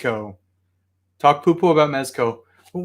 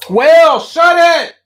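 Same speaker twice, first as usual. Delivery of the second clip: sharp, loud, high shout at the dog; yelling at the dog; 14 semitones higher, 9 dB louder, slower than usual. Real, not acted. Owner yelling at his barking dog, real shout. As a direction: bark it out to silence him.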